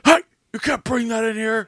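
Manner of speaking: high, loud mock guard's yell; imitated yelling. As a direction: holler across the venue like security. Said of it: "You will get yelled at".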